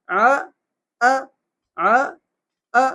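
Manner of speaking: squeezed "ع" against clean "ا"; alternating letter sounds. Shown as a demonstration. Teacher drilling the contrast, separate "ع" and "ا". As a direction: alternate throaty "ع" and light "ا", pausing between. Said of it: "ان کی خوب آواز کو توجہ سے سنیں".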